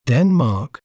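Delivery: Denmark said carefully on its own, falling at the end; pronunciation demo. Shown as a demonstration. Teacher showing viewers how to say the name, careful demonstration of Denmark. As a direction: model the word Denmark clearly, stress on the first syllable. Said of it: "We may pronounce it as".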